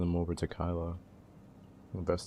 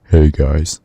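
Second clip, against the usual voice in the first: hammed-up booming hey guys, far louder; mock loud greeting; 20 dB louder than usual. Streamer imagining Kylo without voice changer, loud mock greeting. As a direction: pretend the voice changer slipped and blurt it out loud. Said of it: "He's gonna be like".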